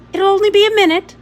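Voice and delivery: high, loud and fast; brisk reassurance. A narrator's reading. Woman driving, tossing a quick promise back to the children, fast and high. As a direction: rattle it off over your shoulder. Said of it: "she said, looking back at us".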